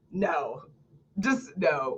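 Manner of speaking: quiet, firm dismissal; soft flat no. Real, not acted. Softly dismissing a speaker's filler words. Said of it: "dismissing it".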